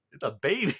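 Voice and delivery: amused laugh through the words. Real, not acted. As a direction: laugh as you say it. Laughing retelling of Koichi's surprise.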